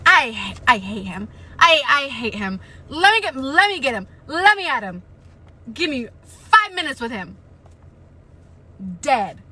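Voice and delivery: loud raging threats; furious. Real, not acted. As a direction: seethe and threaten. Threatening character in rage.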